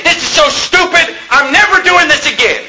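high-pitched, fed-up mock outburst; retold mad yelling. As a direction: re-enact an angry yell of protest. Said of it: "And I was mad".

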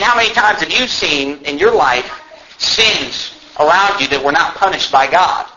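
usual speaking voice

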